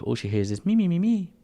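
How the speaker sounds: normal talking voice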